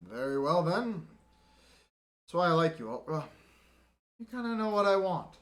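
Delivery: smug approval of a loyal man; haughty officer. Acted. Lieutenant pleased, haughty.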